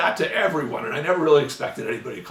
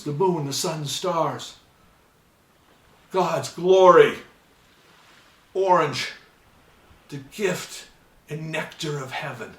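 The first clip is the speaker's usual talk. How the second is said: overblown ad copy; 3 dB louder than usual. Retold or imitated. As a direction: read it like a lofty commercial. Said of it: "the copy was".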